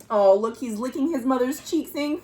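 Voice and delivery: usual speaking voice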